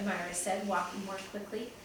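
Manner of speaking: plain reading voice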